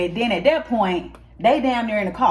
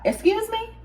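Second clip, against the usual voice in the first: much higher, indignant disbelief; incredulous squeal; 14 semitones higher, 5 dB quieter than usual. Acted. To the valet who upcharged him, a high shocked squeal. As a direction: shoot the pitch way up in shocked outrage. Outraged at hundred-dollar upcharge.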